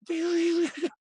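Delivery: high-pitched imitation of a buzzing razor; mimicked clipper buzz. Singer mimics the guy cutting his hair, high buzzing noise.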